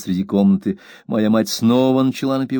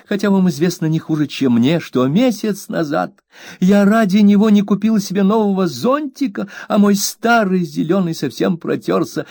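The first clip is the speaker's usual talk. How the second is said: high, aggrieved plea; mother scolding her maid, high and hurt; wounded young mother; 11 semitones higher than usual. A narrator's reading. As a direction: play the hurt, fragile mistress. Mother voiced in hurt quarrel.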